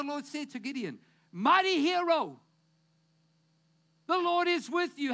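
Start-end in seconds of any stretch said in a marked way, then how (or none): 1.3-5.1 higher and louder proclamation; ringing angelic greeting; proclaiming angel. Acted. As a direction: announce it like a herald.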